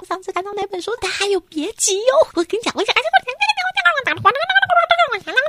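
high, rising eager chatter; excited babbling. Acted. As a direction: babble on unstoppably. Laixi can't stop talking.

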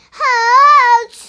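slow, loud and high yummy cry; big delighted shout. Acted. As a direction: shout how tasty it is, drawn out. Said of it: "他们大声说".

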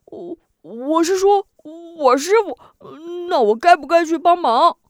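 high, naive hulking fellow asking; a dim giant wondering aloud; simple big brute. A narrator's reading. Long Biao voiced, loud high. (憨傻大汉，声音高而天真)

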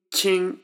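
チン held high and level; チン, chi and in joined, no fall in pitch; first tone. Shown as a demonstration. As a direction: model チン as one steady first-tone syllable. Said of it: "このチンは一声なので".

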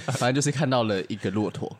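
ordinary talk, nothing marked

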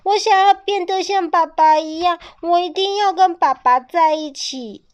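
loud, high crying insistence; weeping baby clings to father; tearful plea. A narrator's reading. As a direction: cry out that you'll stay. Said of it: "泪汪汪的哭着".

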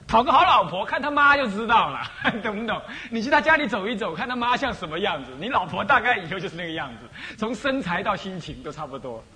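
边笑边讲，语气大声欢快; laughing joke. Real, not acted. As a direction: laugh through the joke about mothers-in-law. Joking about wives, laughing. (讲娶老婆先看丈母娘，边说边笑)

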